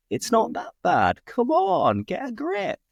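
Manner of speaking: high-pitched mock telling-off; exasperated urging. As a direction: urge a moaning mate to snap out of it, voice shooting up. Acted scolding of a complainer.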